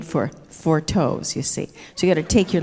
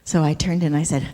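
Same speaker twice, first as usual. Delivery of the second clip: normal talking voice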